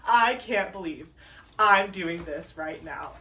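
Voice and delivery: ordinary delivery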